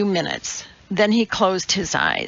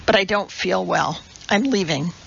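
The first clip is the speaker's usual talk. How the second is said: plain reading voice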